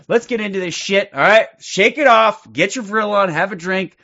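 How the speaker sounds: loud self-hype before the show; pumped up. Real, not acted. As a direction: shake it off and hype yourself up. Host pumping himself up.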